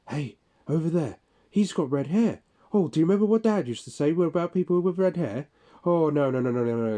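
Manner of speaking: high, loud and fast, ranting at nobody; imitating the coworker's loud babble at his absent mate; loud self-talking coworker. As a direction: mimic a man chattering loudly to an invisible mate. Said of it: "talking really loudly to himself".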